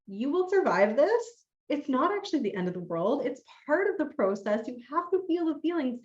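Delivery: supportive self-talk. Acted. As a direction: coach yourself through it, pitch lifted. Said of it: "supporting yourself as the witness".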